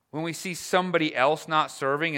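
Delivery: ordinary talk, nothing marked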